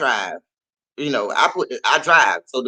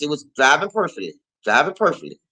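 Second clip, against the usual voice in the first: ordinary delivery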